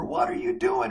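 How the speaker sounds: high mock yell at the opponent; retold yell. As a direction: shout it like an angry teen player. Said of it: "he's just yelling at me and screaming at me".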